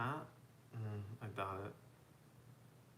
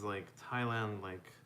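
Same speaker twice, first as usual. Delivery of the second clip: ordinary talk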